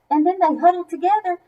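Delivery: ordinary delivery, nothing marked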